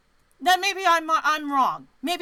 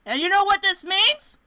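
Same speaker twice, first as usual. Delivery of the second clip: excited; 9 semitones higher, 10 dB louder than usual. Real, not acted. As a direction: build it up triumphantly. Reading the footnote triumphantly.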